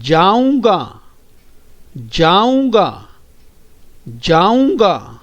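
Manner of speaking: जाऊंगा slow with a nasal u, ending falling; slow drill. Shown as a demonstration. Teacher drilling a nasal word, slow and clear. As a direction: stretch जाऊंगा slowly for the children. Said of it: "आगे दोहराइए बच्चों".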